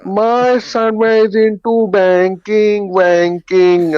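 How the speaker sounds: high, very slow auntie imitation; hammed-up auntie boast, drawn right out; boasting Indian auntie. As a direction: stretch every word in a proud, high auntie voice. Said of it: "she said it with the hand move".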